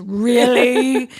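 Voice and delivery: imitated little foreign accent, loud and drawn out; loud mock-incredulous "Really?" in his little accent; Marion Gabrick. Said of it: "In his little accent".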